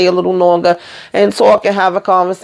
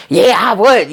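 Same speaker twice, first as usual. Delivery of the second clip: eager quick yes; 4 semitones higher, slower than usual. Retold or imitated. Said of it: "answering real daggone fast".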